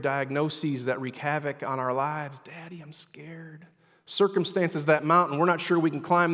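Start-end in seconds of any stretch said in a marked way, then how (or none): 2.4-3.7 very soft, slow plea; small, scared child calling Daddy; frightened child's hush. Retold or imitated. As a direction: drop almost to a whisper.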